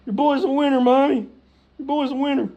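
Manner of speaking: high, loud crowing to Mommy; mock a mama's boy bragging on himself; boastful son. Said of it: "Every week he calls his mom".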